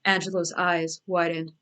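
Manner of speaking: ordinary delivery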